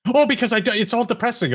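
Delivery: high-pitched mock excuse; lifted, put-on whiny justification; imitated friend's excuse. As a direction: imitate a friend pleading their case. Imitates friends who avoid news.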